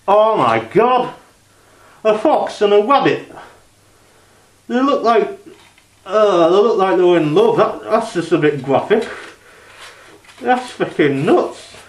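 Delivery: high, loud, appalled squeals with a laugh; shocked outburst. Real, not acted. Reacting to a graphic fox clip, shocked high squeals. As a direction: recoil in real disbelief at what's on screen.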